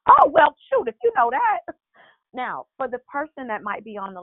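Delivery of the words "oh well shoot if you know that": high, loud burst of agreement; emphatic exclaiming. Acted. Reacting to what Trish already knew, loud exclaiming. Exclaiming at caller's spiritual insight.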